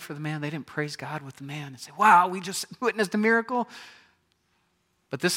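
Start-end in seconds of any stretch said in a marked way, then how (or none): 2.0-3.7 mock amazement. Imagined praising Pharisees.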